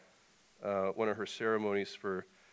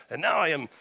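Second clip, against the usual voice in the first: lightly put-on Russian accent, raised pitch; louder Russian character voice; North; 5 semitones higher, 5 dB louder than usual. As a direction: announce the next layer as a Russian Santa. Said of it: "Russian voice".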